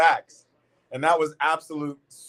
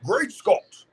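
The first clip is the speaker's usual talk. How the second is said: high Scottish exclamation in a Sean Connery impression; a playful stab at a Connery-style Scottish accent; 12 semitones higher, 5 dB louder than usual. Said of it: "I'm gonna get my Scottish right".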